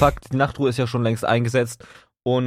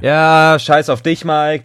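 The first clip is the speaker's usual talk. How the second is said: mock outburst; 5 semitones higher, 7 dB louder, much slower than usual. Friend teases him, mock outburst.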